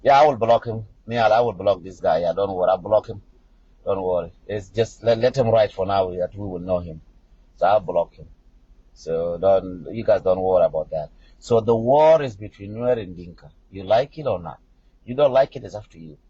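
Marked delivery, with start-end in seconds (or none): none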